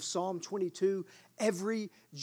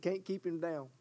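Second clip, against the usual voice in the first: ordinary talk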